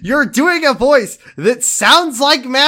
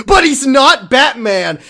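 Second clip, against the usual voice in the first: ordinary delivery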